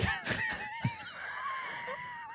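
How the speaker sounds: very high, shrieking laugh. Real, not acted. Huge laugh.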